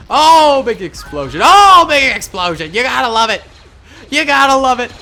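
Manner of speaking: loud, high whoops of delight; excited cheering. Real, not acted. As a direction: cheer the blast at the top of your range. Cheering a big explosion.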